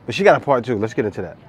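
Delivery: ordinary talk, nothing marked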